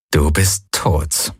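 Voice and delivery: slow, low pronouncement; cool finality. A narrator's reading. Pilot tells the monster its fate, slow finality. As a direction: draw out each word, low. Victor addresses slain monster.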